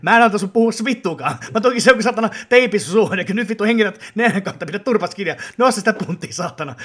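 loud furious drill-sergeant rant; angry yell. Acted. Trainer scolds a chatty gym partner in a loud furious yell. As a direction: bark orders at a lazy gym buddy.

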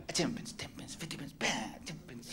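soft unvoiced step count; whispered counting. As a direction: mutter the count while dancing. Counting while dancing with girl.

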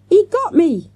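high, loud cry of being hit; shrieked. A narrator's reading. Girl clutching her chest, shrieking. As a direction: wail it high as if wounded. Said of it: "shrieked Avril".

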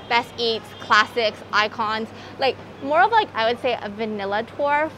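muffled behind surgical mask; through a face mask. Real, not acted. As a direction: mouth covered by mask.

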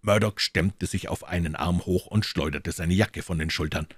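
ordinary delivery, nothing marked

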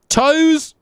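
gleeful loud cry; shout. Real, not acted. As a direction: call the word out big. Shouting the summon word.

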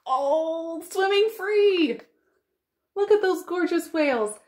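rejoice as they swim free; delighted. Real, not acted. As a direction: glad wonder. Joy at freed whales.

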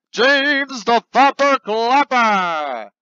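loud, slow announcer call; hammed-up prize announcement; award announcer. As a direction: announce the winner grandly.

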